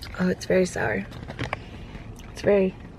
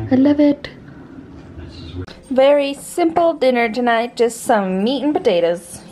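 normal talking voice

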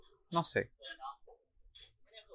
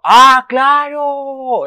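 high, loud, very slow mock "ah, claro"; exaggerated mock agreement; drawn-out sarcastic exclamation; 14 semitones higher, 11 dB louder, much slower than usual. Real, not acted. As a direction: stretch it out sarcastically. Sarcastic reply to game.

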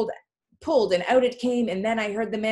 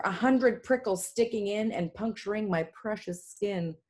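plain reading voice

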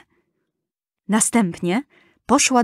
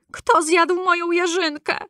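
fourth dwarf asking; 8 semitones higher than usual. A narrator's reading.

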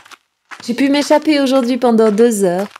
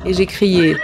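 usual speaking voice

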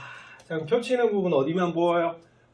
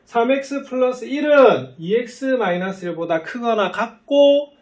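loud, raised reading that climbs to 같고; deliberate read-out; 7 semitones higher, 5 dB louder than usual. Shown as a demonstration. Teacher reading an example inequality for students, deliberate read-out. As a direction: build up to a high, loud 같고 to mark the first half. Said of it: "요거는 어떻게 읽는다고요".

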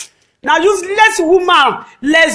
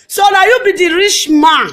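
normal talking voice

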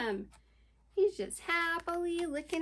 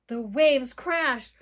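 ordinary delivery, nothing marked

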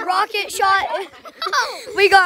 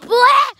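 zombie bleh; 6 semitones higher, 4 dB louder than usual. Pretend. Playing zombie.

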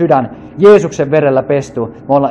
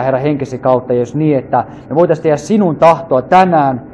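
normal talking voice

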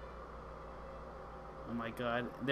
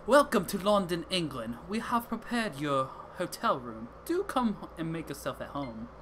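put-on British accent. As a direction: welcome guests in a put-on London accent. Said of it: "That's, that was terrible".

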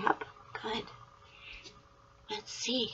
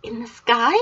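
normal talking voice

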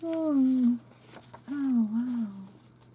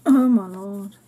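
ordinary talk

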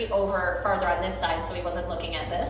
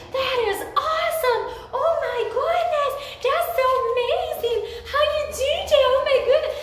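very high, gushing excited praise; over-the-top praise; 4 dB louder than usual. Shown as a demonstration. Trainer gushing at her dog over a small win, very high and excited. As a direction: rave at the dog like he won the lottery. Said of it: "I made it seem like he just, you know, won the lottery".

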